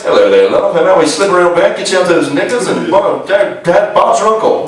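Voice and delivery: put-on low, smarmy British accent; British accent, low and slick come-on; mock British charmer. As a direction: purr it like a cheesy English seducer. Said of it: "a guy came up to you and were like".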